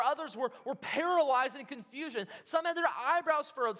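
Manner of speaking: normal talking voice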